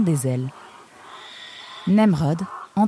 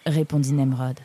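usual speaking voice

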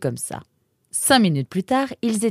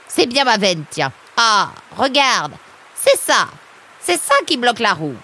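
louder, higher vexed exclamations; exasperated; 6 semitones higher, 6 dB louder than usual. Acted. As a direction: lament your luck and point out the culprit. Bad luck, finds the blockage.

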